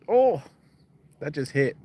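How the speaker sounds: sudden loud high yelp; jolted by the stench; startled outcry. Real, not acted. Bait smell hits him suddenly.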